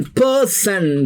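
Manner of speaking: Person said slowly and clearly on its own; slow teacher emphasis on person; root word drawn out. Shown as a demonstration. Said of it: "personification".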